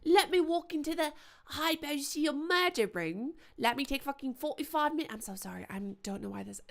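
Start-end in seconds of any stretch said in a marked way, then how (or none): 0.0-5.1 higher, louder sarcastic put-on of Charlie's accent; mock the character in Charlie's accent; mimicking Charlie's accent. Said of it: "I think I'm still mimicking Charlie".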